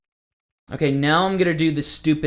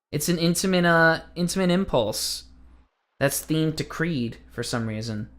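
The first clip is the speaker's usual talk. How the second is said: ordinary talk, nothing marked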